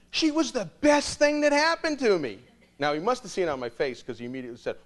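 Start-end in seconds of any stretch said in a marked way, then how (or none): 0.1-2.4 enthusiastic. Retold or imitated. Coworker praises Amy eagerly.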